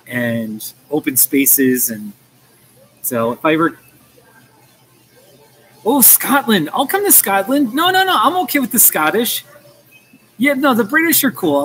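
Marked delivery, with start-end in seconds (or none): 5.8-11.6 high-pitched mock-excited squeal of a voice; playful high voice. Excited mock reply about Scotland.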